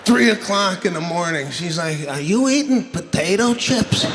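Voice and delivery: full-volume ranting delivery; loud comic rant. Real, not acted. Comic rants to the crowd about bad sleep, loud. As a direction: blast it at the crowd.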